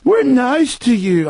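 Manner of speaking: high, slow singsong fake niceness; mock-friendly official. Host mocking officials at public meeting, syrupy fake-nice voice. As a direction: coo with grotesquely exaggerated friendliness. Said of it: "nice little public meeting".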